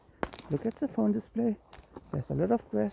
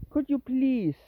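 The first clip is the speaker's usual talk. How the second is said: higher, drawn-out plea with a falling end; insistent plea; 7 semitones higher, slower than usual. Real, not acted. Owner urging the dawdling cat, insistent high plea. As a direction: plead again, more pointedly.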